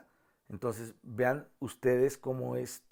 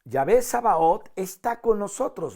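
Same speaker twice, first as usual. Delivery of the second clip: raised, loud soprano-part proclamation; parte de sopranos; 5 semitones higher, 7 dB louder than usual. Shown as a demonstration. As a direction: proclaim it high and strong as the sopranos' line. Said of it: "ahora vienen las sopranos". (voz de soprano, alta y fuerte)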